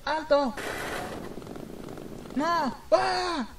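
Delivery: high, panicked cry of stop; mock scream. Victim crying out in terror, pitched high. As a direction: shriek it like someone being attacked.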